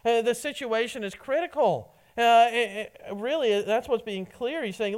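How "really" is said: ordinary talk, nothing marked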